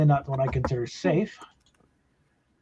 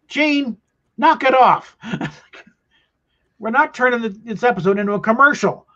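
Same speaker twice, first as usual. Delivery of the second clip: exasperated actor; 8 semitones higher, 4 dB louder than usual. Retold or imitated. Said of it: "Shatner and Nimoy was".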